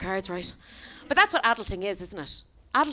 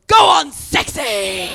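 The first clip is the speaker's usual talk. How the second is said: drawn-out, high shout from a van; rowdy catcall yelled across traffic; hollered catcall; 6 semitones higher, 11 dB louder, much slower than usual. Acted. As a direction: holler it out, loud and slow. Van driver hollers out window.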